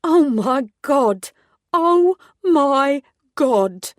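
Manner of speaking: stretched, high, joyful disbelief; astonished excitement. A narrator's reading. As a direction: she can't believe who's standing there. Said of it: "transforming from tired exasperation to astonished excitement".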